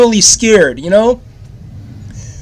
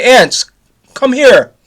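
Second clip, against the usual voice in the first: higher, louder call, short of a real shout; retold yell; 7 semitones higher, 5 dB louder, slower than usual. Child calls aunt over after waking from dream, mock yell. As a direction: imitate a child calling out for help. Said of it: "and I did yell to my".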